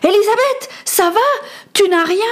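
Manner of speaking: high, urgent call over the storm. Acted. Boy calls to his friend over the storm, shouting high. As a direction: shout her name so she hears you. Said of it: "cria pour couvrir le bruit".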